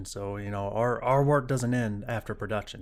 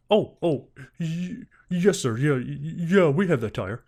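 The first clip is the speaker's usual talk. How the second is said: higher and louder, stammering caught-out clerk; mock the salesman tripping over his words; flustered salesman; 4 semitones higher, 6 dB louder, slower than usual.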